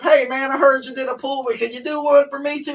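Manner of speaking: high, loud eager rich customer; rich client voice. Retold or imitated. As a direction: play a rich guy asking a favor. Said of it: "they're gonna hit you up".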